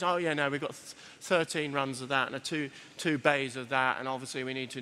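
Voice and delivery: mock a manager reeling off shop detail; low-pitched managerial drone; analysing store staff. Imitates staff over-analysing their store.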